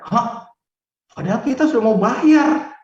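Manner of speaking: high, loud disbelieving cry; shocked outburst. Retold or imitated. Wife hears the land is cheaper, shocked loud outcry. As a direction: blurt it out in shock.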